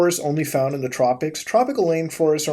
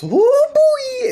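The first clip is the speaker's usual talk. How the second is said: excited whoop; 6 dB louder than usual. Real, not acted. Vlogger whooping at a page on anti-bubbles, excited. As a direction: whoop it way up high.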